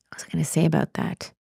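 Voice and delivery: a quick murmured aside while you think; whispered aside. Real, not acted. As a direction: whisper it to yourself, searching for the thought. Aside while losing her thought.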